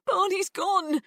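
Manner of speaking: high, loud, upset; distraught. A narrator's reading. Tearful girl telling her dad the dog is gone, high and upset. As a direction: blurt it out in distress.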